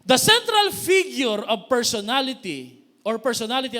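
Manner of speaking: loud declaration from the pulpit; forceful preaching. Acted. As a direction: proclaim it to the hall.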